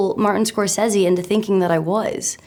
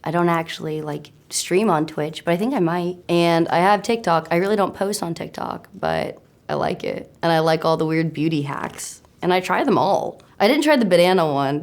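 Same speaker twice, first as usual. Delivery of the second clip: ordinary talk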